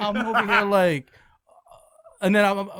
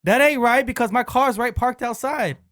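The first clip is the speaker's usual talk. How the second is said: louder, higher, drawn-out indignation; offended protest; 6 semitones higher, 4 dB louder than usual. Retold or imitated. Coworker answering a prank car wash call, offended. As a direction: imitate an indignant coworker, slowly insisting. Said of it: "he's getting offended because he goes".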